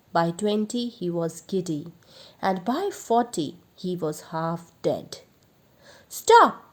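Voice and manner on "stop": sudden high, loud scream. A narrator's reading. Thief screaming for the lashing to stop. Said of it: "he screamed".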